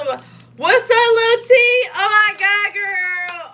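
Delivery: high, loud squeal of welcome; delighted greeting. Real, not acted. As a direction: gush loudly at a beloved little one. Excitedly greets visitor Lil T.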